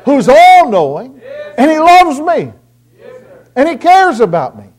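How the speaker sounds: loud, high fervent preaching. Acted. Preacher pleading with his congregation, loud and fervent.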